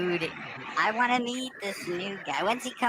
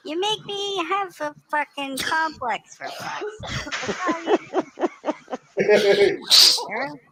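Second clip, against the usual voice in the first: mock scolding; 4 semitones higher, 7 dB louder than usual. Mock scolding of laughing friend.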